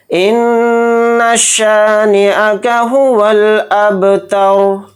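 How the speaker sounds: slow, drawn-out chanted tilawat; Quranic recitation. Acted. Teacher opening the lesson, slow chanted recitation. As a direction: intone each word slowly and reverently.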